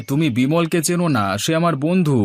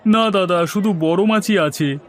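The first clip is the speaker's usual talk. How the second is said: higher put-on voice calling out to a customer; fish seller; 7 semitones higher than usual. Fish seller touting his rui to a passing man, higher put-on voice. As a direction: hawk fresh fish in a raised voice.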